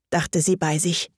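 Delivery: plain reading voice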